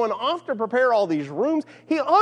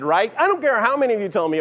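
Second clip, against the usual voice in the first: ordinary talk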